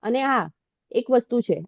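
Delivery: ordinary talk, nothing marked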